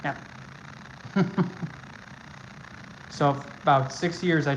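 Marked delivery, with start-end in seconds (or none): 0.2-3.0 quiet airy chuckle; soft breathy laugh. Real, not acted. Laughs after own remark.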